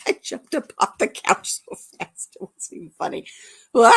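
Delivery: comic fake wail; mock crying. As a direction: fake a wah cry, then laugh. Comic cry about finger poke.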